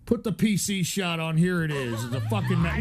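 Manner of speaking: usual speaking voice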